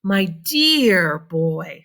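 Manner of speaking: a slow, lightly voiced old man; grandpa's voice. A narrator's reading. Lightly voiced Grandpa Joe addressing the boy. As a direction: savor it like a fond grandfather. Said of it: "said Grandpa Joe".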